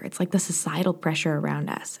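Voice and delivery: ordinary talk, nothing marked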